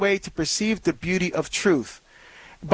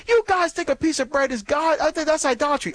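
challenging sceptic, pitched higher; scornful critic; 8 semitones higher than usual. Retold or imitated. Critic challenging Catholics about the bread, higher scornful tone. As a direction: scoff at the idea, raised and needling. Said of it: "someone say".

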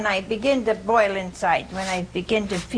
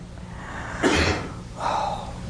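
gasp; 5 dB quieter than usual. Acted. Jesus reacts to arguing apostles.

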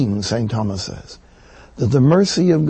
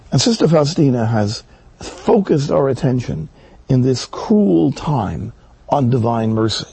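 usual speaking voice

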